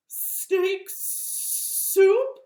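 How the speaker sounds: high, loud, drawn-out S sounds; horrified snake. Acted. Snake learns he's on the menu, horrified sibilant cry. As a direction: echo it sibilantly in mock horror.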